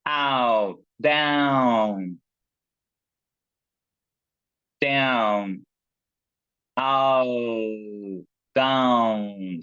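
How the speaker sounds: "down" said with a full ow glide; "Ow, down": model the ow vowel clearly; "down" stretched on its ow sound; vowel demo. Shown as a demonstration. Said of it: "the last one is".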